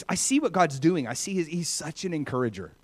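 ordinary talk, nothing marked

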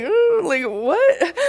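high-pitched retold amazement; freaking out. Praying woman seeing a knee healed, retold freak-out. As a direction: imitate a stunned reaction, voice leaping up. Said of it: "I'm freaking out".

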